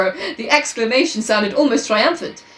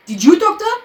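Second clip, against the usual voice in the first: Poirot questioning; 10 semitones higher, 5 dB louder than usual. A narrator's reading. Poirot figure in story.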